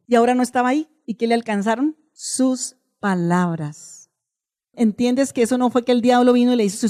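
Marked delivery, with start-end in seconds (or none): 2.1-4.1 very slow, softer, lower; slow dramatic reveal. Acted. Preacher revealing the answer, slow and low. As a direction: drag it out gravely. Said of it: "dramatic slow answer".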